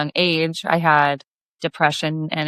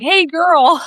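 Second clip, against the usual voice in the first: put-on higher, a bit louder, rising hey girl; mock catcall; 11 semitones higher, 5 dB louder than usual. A fisherman calling to her across the cove, mock catcall. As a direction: call out across the water.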